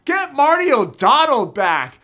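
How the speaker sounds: a fan's heartfelt loud plea; emphatic demand. Real, not acted. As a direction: call it out like a rallying cry. Passionate demand for Marty back.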